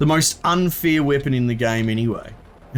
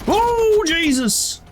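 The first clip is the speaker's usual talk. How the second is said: startled yelp; much slower than usual. Real, not acted. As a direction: yelp in sudden real shock. Explosion on screen.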